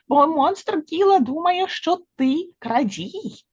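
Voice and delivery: high fly voice, slowing at the end; warning fly. A narrator's reading. Fly tells chipmunk of the accusation, high-pitched. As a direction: warn up high, drawing out the last words.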